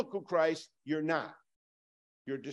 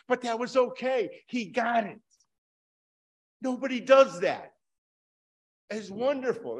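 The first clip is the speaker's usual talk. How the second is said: delighted amazement; 7 semitones higher, 6 dB louder than usual. Real, not acted. Still delighted by the story.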